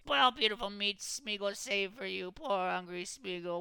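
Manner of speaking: high, slow, self-pitying creature whine; pitiful Gollum whine; whining creature. A narrator's reading. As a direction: mourn the lost meat. Gollum laments the rabbits.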